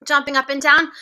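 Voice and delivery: plain reading voice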